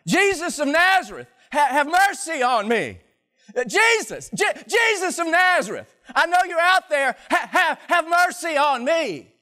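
desperate shout, high and loud; yelling out. Acted. Blind beggar shouting to Jesus over the crowd, desperate yell. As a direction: cry out to be heard over the crowd. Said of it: "he starts yelling out".

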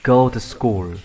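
"go to school" joined up, "to" reduced to "tuh"; smooth model "go to school", strong GO, short "to"; linked reading. Shown as a demonstration. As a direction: run "go to school" together with a weak "to". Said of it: "Bạn đọc liền vào".

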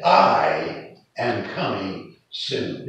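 I held long, then slow, weighty words; stress on I. Retold or imitated. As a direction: stretch I out to insist it is personal. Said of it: "Jesus didn't say, I'm gonna send my emissary".

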